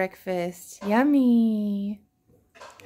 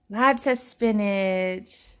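slow coaxing; 3 semitones lower, much slower than usual. Real, not acted. Coaxing toddler to add spinach.

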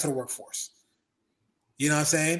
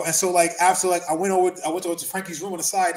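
ordinary delivery

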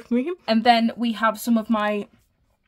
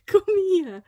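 high coaxing pet voice; talking to a pet; 9 semitones higher, 4 dB louder than usual. Real, not acted. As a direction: call the dog over sweetly. Calling the dog over.

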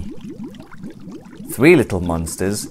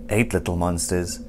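plain reading voice

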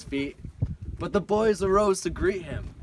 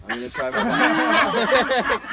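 laughing; 10 semitones higher, 10 dB louder, slower than usual. Real, not acted. Laughing before pirate recital.

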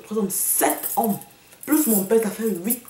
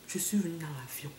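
dropped almost to a whisper; hushed aside; 4 semitones lower, 13 dB quieter than usual. Real, not acted. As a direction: say it very low and soft, nearly voiceless. Hushed boast after denying it.

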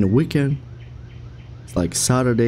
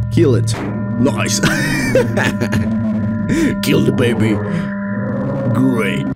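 raised, gleeful voice; 3 semitones higher than usual. Real, not acted. Game choice, loud.

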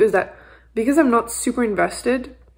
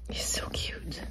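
breathy whisper to the kitten; whispered coo; 13 dB quieter, slower than usual. Real, not acted. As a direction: whisper it tenderly.

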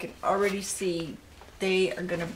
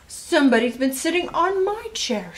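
high, louder storybook mother, indignant; Missus Blair; 7 semitones higher, 5 dB louder than usual. A narrator's reading. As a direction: complain as mama, pitched up high. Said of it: "said Missus Blair".

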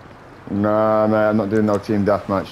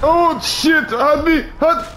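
high, loud shriek of surprise; startled yelp; 5 dB louder, much slower than usual. Real, not acted. Gamer caught off guard, shrieking in surprise. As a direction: yelp as someone jumps you. Sudden ambush in game.

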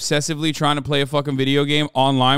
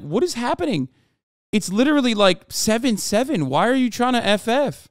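high-pitched acted-out disbelief; exasperated, high bewildered pleading; baffled protest; 7 semitones higher than usual. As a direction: replay your incredulous objection, pitched way up. Acting out bafflement at teammates forfeiting.

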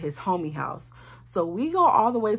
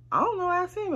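much higher, sassy brush-off; mock fed-up shrug; exasperated; 11 semitones higher than usual. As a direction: toss it off like it's not your problem. Exasperated reply to the woman.

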